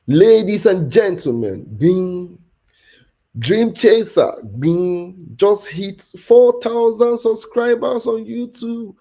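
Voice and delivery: high, loud, drawn-out hype man intro. Pretend. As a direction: announce it like an arena hype man. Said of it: "let me form a hype man".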